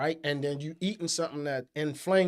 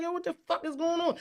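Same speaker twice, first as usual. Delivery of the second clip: alarmed disbelief; 10 semitones higher, 3 dB quieter than usual. Retold or imitated. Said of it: "I'm like".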